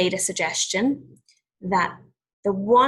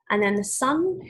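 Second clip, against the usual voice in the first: plain reading voice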